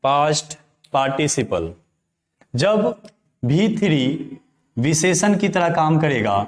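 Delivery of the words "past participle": slow word-by-word dictation. Shown as a demonstration. Teacher dictating a definition to students, one word at a time. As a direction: say each word alone with long pauses between.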